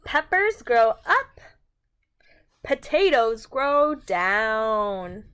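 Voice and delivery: slow, emphatic read-aloud with big sing-song swings; animated storytime voice; reading to children. A narrator's reading. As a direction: read it out like a picture book for little ones. Reading picture book to children.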